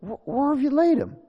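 high, stammered question; troubled Jesus. Acted. Jesus asking the mourners about Lazarus, high troubled question. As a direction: stumble into a high-pitched question. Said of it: "And he said".

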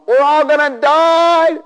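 high, slow mock panic wail; panicked disciples. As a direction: cry it out like terrified men in a boat. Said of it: "they were like going".

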